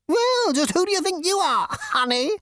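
high, huffy bug voice; flouncing insect. Acted. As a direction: toss it off with sass. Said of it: "flounced the insect".

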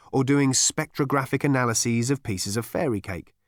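usual speaking voice

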